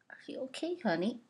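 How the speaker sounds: normal talking voice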